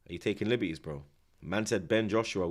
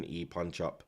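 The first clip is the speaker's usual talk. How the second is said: ordinary talk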